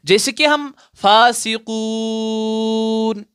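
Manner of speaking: फासिकून with the कू drawn out three alifs long; long stretched madd. Shown as a demonstration. Teacher shows students the three-alif stretch, drawn out. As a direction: pull the कू in फासिकून out slowly. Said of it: "तीन अलिफ के बराबर खींचकर पढ़ना है".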